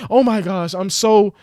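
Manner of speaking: high-pitched mock fretting; mock self-reproach. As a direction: imitate someone fretting at themselves. Mimics people talking down to themselves.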